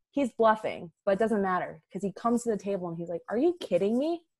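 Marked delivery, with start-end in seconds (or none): 3.3-4.2 mock-offended, higher and louder; a hammed-up insulted boss; insulted Michael Scott. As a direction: play up the outrage at a lowball offer. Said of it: "He was insulted".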